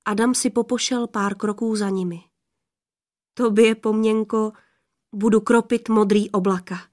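usual speaking voice

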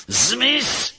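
very high screamed command; high-pitched frantic yell; shriek. A narrator's reading. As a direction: shriek it hysterically. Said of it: "ječel na raketu".

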